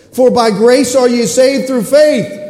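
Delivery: loud, slow, ringing scripture; a booming verse reading; preached verse. Real, not acted. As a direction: proclaim it slowly from the pulpit. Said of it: "scripture read with force".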